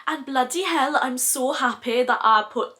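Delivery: lilting mock-Welsh accent; bad Welsh accent. Vlogger gushing over a favourite blush in a bad Welsh accent. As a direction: slip into a badly faked Welsh accent. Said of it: "doing this really bad Welsh accent".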